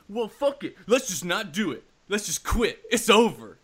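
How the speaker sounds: loud, high mock tantrum; hammed-up fed-up quitting; hardcore outburst. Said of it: "to, like, hardcore".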